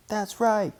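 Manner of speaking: high, breathless agreement; gasped. A narrator's reading. Sister cub gasping agreement to Papa. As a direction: sister gasping her yes. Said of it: "gasped sister".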